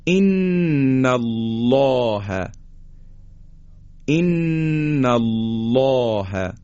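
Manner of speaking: ان الله with long humming n and thick dark lam; heavy taghliz. Shown as a demonstration. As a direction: chant ان الله very slowly, الله backed and rounded. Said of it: "بایستی درشت و با غلظت ادا کنیم".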